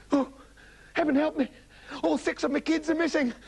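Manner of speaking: high, tearful wail; hammed-up distraught mother; panicking mother. Mother panics over missing kids.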